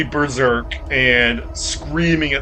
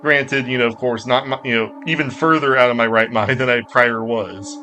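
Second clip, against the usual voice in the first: ordinary talk, nothing marked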